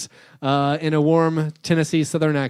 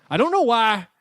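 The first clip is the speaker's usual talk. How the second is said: put-on Southern accent; 2 semitones higher than usual. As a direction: mimic an older Southern man puzzling over his app. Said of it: "that's my one Southern accent".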